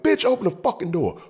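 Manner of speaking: high-pitched retold door demand; acting out a furious order; angry demand.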